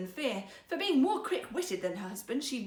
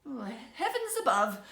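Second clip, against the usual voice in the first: bitterly disappointed; 4 dB louder than usual. A narrator's reading. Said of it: "bitterly disappointed".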